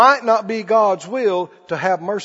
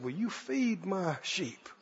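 soft, slow and gentle; Jesus gently asking Peter; tender question; 3 semitones lower, 17 dB quieter, slower than usual. Acted. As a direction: ask him kindly, almost hushed.

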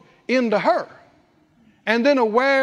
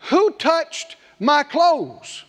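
slow searching question; 3 semitones higher, much slower than usual. Retold or imitated. Jesus asks in the crowd.

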